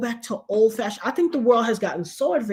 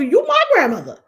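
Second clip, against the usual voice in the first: indignant protest; 7 semitones higher, 5 dB louder than usual. Retold or imitated. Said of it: "All I could think of".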